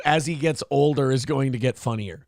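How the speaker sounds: ordinary talk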